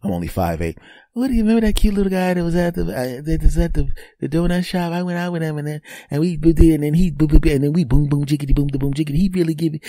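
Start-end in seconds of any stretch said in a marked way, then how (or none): 1.1-9.8 higher, fast gossipy woman's voice; imitating the officer. Officer telling her partner about the date, higher and gossipy. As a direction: gush to a friend. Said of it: "what do you think she's gonna say".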